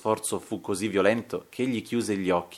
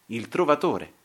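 ordinary delivery

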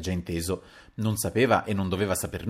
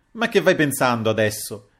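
louder protest cutting him off; interrupting exclamation; 3 semitones higher, 6 dB louder than usual. Acted. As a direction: break in on him with a raised voice. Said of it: "esclamò interrompendolo il Paleari".

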